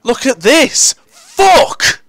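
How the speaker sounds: high-pitched yell; exasperated shout. Real, not acted. Gamer swearing at the match, exasperated shout. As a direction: burst out with a loud, high curse.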